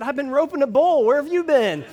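loud, high, proud comeback; cocky retort. Retold or imitated. Husband boasting to his wife on the phone about roping a bull. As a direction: toss her question right back, swaggering.

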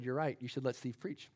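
soft, low punchline delivery; gentle deadpan. Retold or imitated. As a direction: drop it quietly, as if kindly. Kids' gentle deadpan reply retold.

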